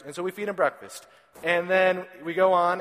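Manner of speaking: normal talking voice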